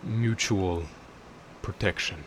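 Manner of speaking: slow, metallic, distant voice. Acted. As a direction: intone it from the abyss. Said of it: "you can hear this metallic voice".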